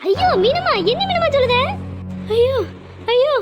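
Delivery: shocked wail. Acted. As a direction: wail out in horror at the news. Mother hears daughter took poison. (அதிர்ச்சியில் உயர்ந்த அலறல்)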